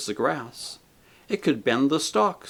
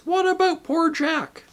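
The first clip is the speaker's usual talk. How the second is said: outraged demand. A narrator's reading. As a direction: hurl the question at the murderer. Voices the big ghost.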